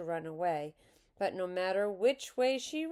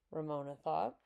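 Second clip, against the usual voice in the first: ordinary delivery, nothing marked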